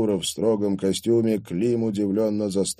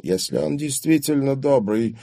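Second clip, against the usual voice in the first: sullen, higher-pitched reply; gloomy retort; 7 semitones higher than usual. A narrator's reading. Old father answers Klim's greeting, gloomily. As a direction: answer with a scowl. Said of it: "хмуро ответил Далмацкий".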